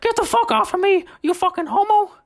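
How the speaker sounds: high squeaky Mickey Mouse yell; Mickey Mouse shouting. Retold or imitated. Drunk Mickey Mouse yelling at the new guy, high squeak. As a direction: shove him off in a shrill Mickey squeal. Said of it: "I am directly quoting him".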